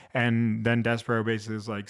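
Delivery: normal talking voice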